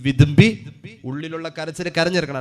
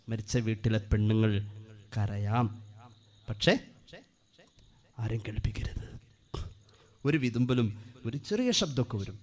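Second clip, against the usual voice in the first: much softer and lower; quiet; 8 semitones lower, 10 dB quieter than usual. Real, not acted. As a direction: speak it gently and low. Softly explaining quiet grief.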